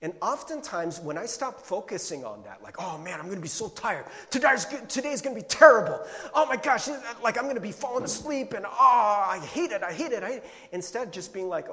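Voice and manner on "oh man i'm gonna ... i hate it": mock whining that swells into a loud outburst; exasperated griping. Preacher imitates himself hating being tired, hammed-up exasperation. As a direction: act out an overblown morning complaint. Acts out morning dread and frustration.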